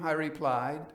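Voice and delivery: usual speaking voice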